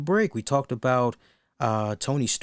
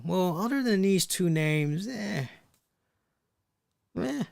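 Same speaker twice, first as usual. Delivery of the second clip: higher-pitched, trailing off into "eh"; dismissive indifference; 5 semitones higher than usual. Acted. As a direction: shrug it off as the bookers would. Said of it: "That's how it comes off".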